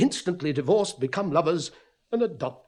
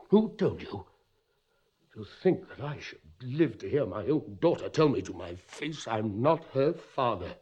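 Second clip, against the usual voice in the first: normal talking voice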